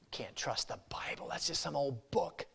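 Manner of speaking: hushed, unvoiced tempting whisper; devil's whisper. Devil whispering doubts to the unsaved, sly whisper. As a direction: breathe the doubt into their ear.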